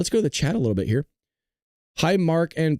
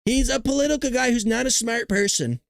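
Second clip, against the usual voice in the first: higher-pitched imitation of a politician; playful mimicry, pitched up; Donald Trump; 6 semitones higher than usual. Streamer quoting President Trump on the Fed chair, mock Trump voice. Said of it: "I love the way he just talks".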